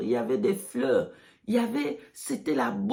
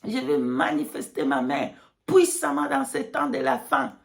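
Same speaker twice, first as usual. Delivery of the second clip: prophetic proclamation; 3 semitones higher than usual. Pretend. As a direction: preach it with rising force. God's message quoted.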